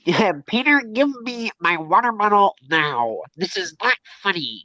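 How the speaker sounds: whiny nasal demand, mocking him. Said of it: "That's actually what he sounds like".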